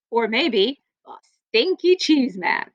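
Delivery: ordinary delivery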